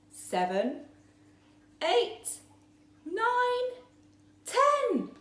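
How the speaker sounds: building count. A narrator's reading. Counting, rising in excitement.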